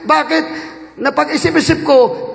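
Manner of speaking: normal talking voice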